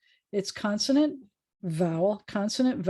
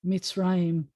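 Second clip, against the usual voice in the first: ordinary talk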